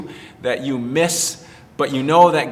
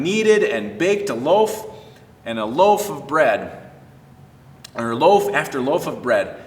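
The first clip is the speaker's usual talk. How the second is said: put-on reading to children; 2 semitones higher than usual. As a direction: tell the Bible story to kids with bright expression. Children's story read aloud.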